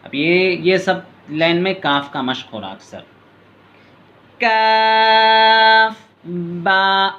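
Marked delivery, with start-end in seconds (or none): 4.4-7.1 slow, high, louder chanted lam alif; drawn-out recitation. Shown as a demonstration. Teacher demonstrates the lesson's letters to pupils, slow chanted and louder. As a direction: hold लाम very long, then a falling अलिफ. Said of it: "काफ से हरफी है".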